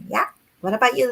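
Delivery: normal talking voice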